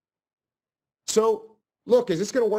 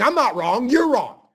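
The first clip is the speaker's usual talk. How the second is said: louder, higher, combative snap; mock angry retort; 6 semitones higher, 6 dB louder than usual. Answering a yelling customer with a loud, higher retort. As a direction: bark it back like a stubborn client. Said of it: "Instead of saying".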